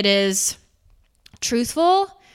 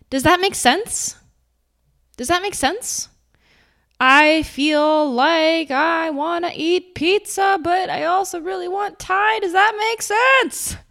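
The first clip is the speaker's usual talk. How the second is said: much higher, insecure questioning voice; unsure; 9 semitones higher, 4 dB louder, slower than usual. Shown as a demonstration. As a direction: seek approval with every phrase. Mocking validation-seeking.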